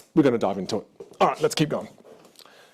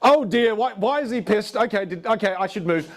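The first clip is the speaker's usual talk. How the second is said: retold alarm; 8 semitones higher than usual. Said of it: "And I was like".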